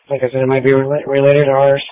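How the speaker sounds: ordinary talk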